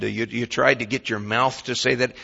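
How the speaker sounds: normal talking voice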